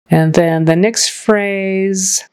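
normal talking voice

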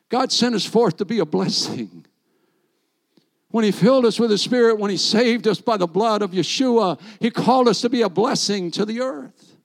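loud, rising preacher's proclamation; preaching at full force. Acted. Preacher proclaiming his calling to the congregation, loud. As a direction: proclaim it to the whole church.

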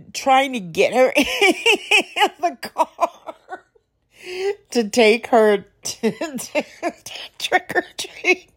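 breaking into laughter mid-sentence; giggling through the words; laughing. Real, not acted. Laughing while telling mummy story.

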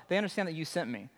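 rattled off fast with no weight on you; flat hurried version of the quote; quick throwaway read. Shown as a demonstration. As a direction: toss the line away quickly. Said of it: "It's not like".

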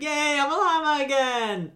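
high chirpy mock delight; fake cheer. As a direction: cheer like it's good news.

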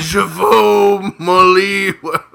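drawn-out mock French, laughing through it; a slow, laughing "Je veux mon livre". Shown as a demonstration. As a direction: say it slowly in hammy French with a laugh. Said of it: "I want my book".

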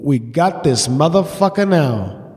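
low, slow gloating thought; inner scheming voice. Retold or imitated. Husband plotting over Lego, low slow gloat. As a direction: savour the plan like a villain. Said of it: "And I thought".

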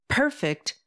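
high-pitched indignation without much extra volume; voice rising. A narrator's reading. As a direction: throw the word back, high and disbelieving. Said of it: "Graham's voice rises in a loud timbre".

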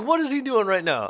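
high-pitched, eager question; excited questioning. Retold or imitated. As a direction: imitate a thrilled man demanding news. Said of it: "he was so excited".

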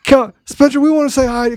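high, slow sing-song coaxing from the comments; high teasing chorus of fans begging Spencer to come on; mock chat pleading. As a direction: voice the chat coaxing him in a high, drawn-out plea. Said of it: "They're literally saying".

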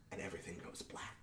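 hushed, quick reveal; dramatic whisper. A narrator's reading. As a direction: drop to a whisper. Narrator's dramatic close.

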